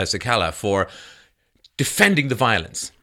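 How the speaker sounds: ordinary talk, nothing marked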